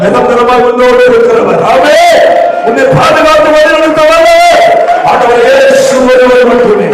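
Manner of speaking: fiery pulpit exhortation; full-force preaching. Acted. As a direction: preach it hard and climb to a shout.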